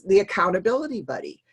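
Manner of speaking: ordinary talk, nothing marked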